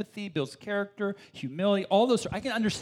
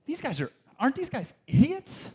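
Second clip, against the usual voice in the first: high, scoffing voice; scornful reader; 9 semitones higher than usual. Retold or imitated. Preacher mocking his younger self's judgment, high scoff. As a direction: sneer at them in disbelief. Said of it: "the question".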